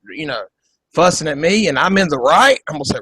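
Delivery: normal talking voice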